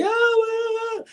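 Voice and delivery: high screams of pain at the front door. Retold or imitated. Wounded man at his front door screaming high for help. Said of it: "I'm screaming bloody murder".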